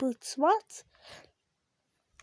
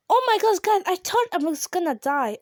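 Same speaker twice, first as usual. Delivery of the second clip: high, loud rush of relief after a close call; relieved outburst; 5 semitones higher, 7 dB louder than usual. Real, not acted. Gamer yelling to viewers after nearly dying, excited relief. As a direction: blurt it out, still shaken.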